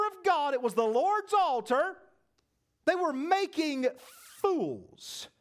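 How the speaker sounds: rising, impassioned pulpit voice; full-force revival preaching; fervent preaching. Acted. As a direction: preach it hard, building. Preacher builds to fervor.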